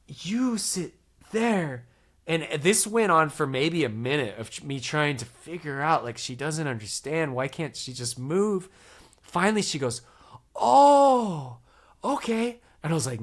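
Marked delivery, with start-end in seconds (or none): 0.1-1.9 drawn-out, word-by-word pointing; very slow instruction. Retold or imitated. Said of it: "trying my best". 10.5-12.6 sudden realization. Retold or imitated. Lady finally agreeing.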